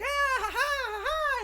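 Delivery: high, airy chorus of laughing; very high happy laugh; mock heavenly laughter. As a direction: laugh like a blissful crowd in heaven. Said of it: "everybody's like".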